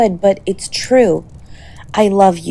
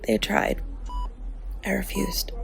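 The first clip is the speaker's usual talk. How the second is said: barely voiced, tender confession; soft whisper; 13 dB quieter than usual. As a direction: whisper it close by the bed. Telling partner they stayed by bed.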